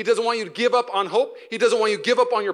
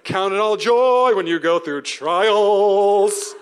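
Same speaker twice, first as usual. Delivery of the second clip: slow, drawn-out, louder mock sage; mock preachy James; 7 dB louder, much slower than usual. Preacher mocking James as relentlessly cheerful, slow and loud. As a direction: intone it slowly like an unreal optimist. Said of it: "Who talks like that?".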